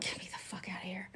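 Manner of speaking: fast, hushed, breathy imitation; mock whisper. Imitating Geminis wanting to flee, fast mock whisper. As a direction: whisper it like a desperate escapee, quickly. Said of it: "You guys are just like".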